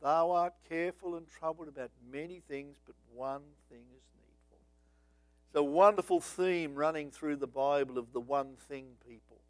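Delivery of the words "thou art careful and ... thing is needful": much softer, gentle correction; gracious correction. Retold or imitated. Jesus gently correcting busy Martha, much softer and gracious. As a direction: softly set her right, kindly. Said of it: "Jesus corrected it graciously and said".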